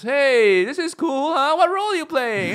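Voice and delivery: very high, loud mock enthusiasm; eager greenhorn. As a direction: gush like a clueless newcomer. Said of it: "the greenhorn".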